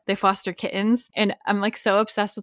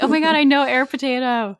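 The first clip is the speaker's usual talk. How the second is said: loud, high excited fan squeal; 5 semitones higher, 5 dB louder than usual. Pretend. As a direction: gush like a thrilled fan. Imagined excited fan quote.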